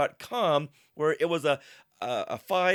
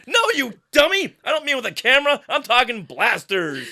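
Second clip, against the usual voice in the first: high, loud exasperated yell; raised, indignant character shout; acted yell; 8 semitones higher, 6 dB louder than usual. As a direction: yell back at a dim-witted sidekick. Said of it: "And Ars yells back".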